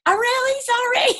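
high, sheepish apology; lightly voiced embarrassed woman; mock apologetic woman. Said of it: "And she's like".

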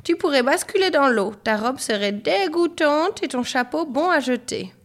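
high-pitched motherly fussing; scolding mother. A narrator's reading. Mother warns Marine about falling in, high scolding.

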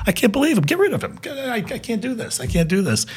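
high, fading mock rant; Carson going off. Host ranting about his guest, high fading mock whine. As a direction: pitch it up and whine it out, running out of steam. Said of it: "he starts going off on him".

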